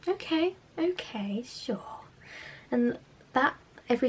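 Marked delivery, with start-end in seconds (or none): 0.1-2.0 slow unvoiced whisper, pitched up; hushed mocking okay; sarcastic whisper. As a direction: whisper it with an eye-roll. Sarcastic inner retort.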